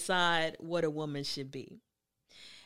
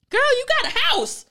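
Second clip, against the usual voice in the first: high, loud, incredulous grandmother yelling; mock the girl's dream of love at the top of your voice; scoffing grandmother; 9 dB louder than usual. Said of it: "And the grandmother was like".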